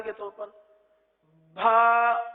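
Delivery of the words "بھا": بھا loud and long with an h after the b, "bhaa"; mispronounced ba as a held "bhaa"; wrong, aspirated ba. Shown as a demonstration. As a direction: add a puff of h to the b in بھا. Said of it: "با کے ساتھ ہا کی آواز نکالی جاتی ہے".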